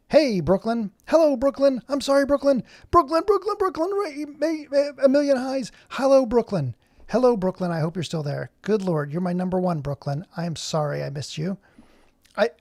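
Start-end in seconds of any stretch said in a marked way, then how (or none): 0.1-7.7 high, loud sing-song calling out; excited greeting. Real, not acted. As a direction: call out to a viewer like she's a long-lost friend. Greeting a viewer in chat.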